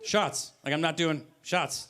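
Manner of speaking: ordinary talk, nothing marked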